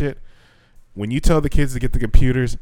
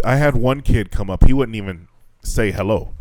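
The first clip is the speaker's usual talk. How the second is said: ordinary delivery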